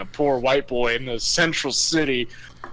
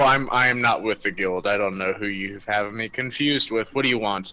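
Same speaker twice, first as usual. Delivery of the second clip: gruff stranger; 6 semitones lower than usual. Acted. GM voicing a stern stranger.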